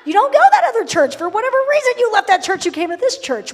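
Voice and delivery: playful roaring comeback; laughing shout. Real, not acted. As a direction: shout it back through a laugh. Pastor shouting back, laughing.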